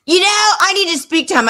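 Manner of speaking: put-on high-pitched insistent girl caller; imitated caller. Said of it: "She goes".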